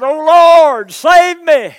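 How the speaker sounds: full-voiced shout, high and loud; a sinking man screaming to the Lord; desperate cry for help. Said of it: "And he cried out".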